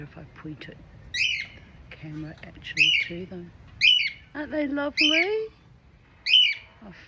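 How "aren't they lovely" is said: high, bright and fond; delighted. Real, not acted. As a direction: gush over the birds. Showing off her crow family.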